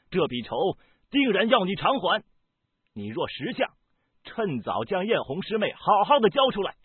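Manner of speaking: heated, high-pitched accusation; indignant tirade with shouted peaks; angry. A narrator's reading. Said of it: "怒道".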